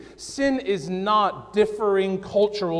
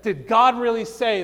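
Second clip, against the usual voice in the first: usual speaking voice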